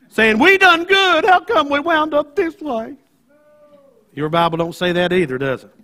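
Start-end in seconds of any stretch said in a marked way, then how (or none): 0.4-3.0 high, whiny self-pity; mock whining. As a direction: whine like a hard-done-by child. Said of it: "crying and whining".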